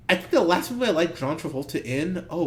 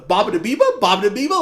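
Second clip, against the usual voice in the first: mock Italian gibberish; 4 semitones higher, 9 dB louder, faster than usual. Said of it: "that Family Guy joke".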